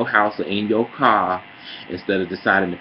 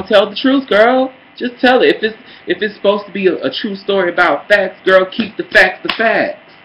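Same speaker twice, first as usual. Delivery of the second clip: high, louder diva voice throughout; quoting Jamal; 12 semitones higher, 8 dB louder than usual. Retold or imitated. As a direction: preach it high and loud like a diva. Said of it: "To quote Jamal".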